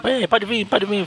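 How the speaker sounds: taunting challenge. Acted. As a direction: goad him to come at you. Taunting villain quote, high.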